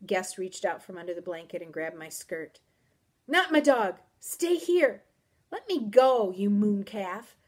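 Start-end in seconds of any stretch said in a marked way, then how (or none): 3.3-5.0 loud, high, alarmed plea; forceful frightened warning; urgent protest. A narrator's reading. Guest grabs her, pleading. 5.5-7.4 loud, indignant outburst; exasperated and forceful; angry protest. A narrator's reading. Girl struggles to pull away.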